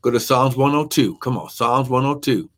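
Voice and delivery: ordinary delivery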